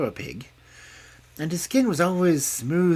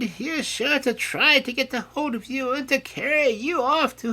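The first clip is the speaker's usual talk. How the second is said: high, earnest warning, louder at the end; mother pig warning her children about the fox; old mother pig warning; 4 dB louder than usual. A narrator's reading. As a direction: make them promise. Mother pig's last warning.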